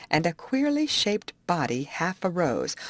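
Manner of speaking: usual speaking voice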